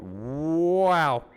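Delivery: long, loud, rising-and-falling cat yowl; playful loud cat impression; cat meow. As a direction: let out a drawn-out, high meow like a cat. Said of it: "I guess I am a cat".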